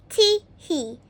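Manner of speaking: a sly little titter of glee; plotting giggle; gleeful chatter. A narrator's reading. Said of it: "he chattered".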